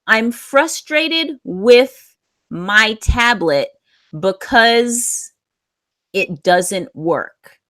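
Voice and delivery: slow, careful dictation pace; slow model sentence. Shown as a demonstration. Teacher slowly reading a student's corrected sentence. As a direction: read it slowly so students catch each word. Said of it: "So we're gonna fix your sentence a little bit".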